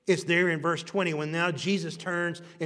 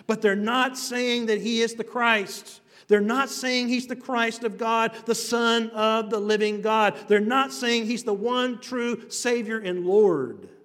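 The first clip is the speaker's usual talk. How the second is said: high, loud preaching at full force; fervent preaching; 6 semitones higher, 6 dB louder than usual. Acted. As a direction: preach it out, lifting the crowd. Preacher hammering a point.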